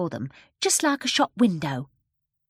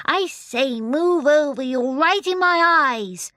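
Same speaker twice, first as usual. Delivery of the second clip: put-on high, loud, slowed baby voice; a baby calling out, bossy and loud; loud baby John; 9 semitones higher, 7 dB louder, slower than usual. A narrator's reading. Baby John shouting at the sunlight to move. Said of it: "said John in a loud voice".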